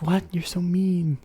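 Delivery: high, mock-offended whine; mock hurt friend. Teasing a sassy friend back, mock-offended high whine. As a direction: fake being hurt, playfully. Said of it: "being sassy to your friends".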